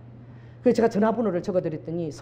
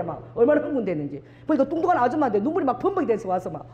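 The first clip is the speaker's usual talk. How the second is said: ordinary talk, nothing marked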